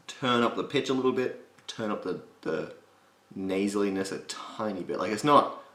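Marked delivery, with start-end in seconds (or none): none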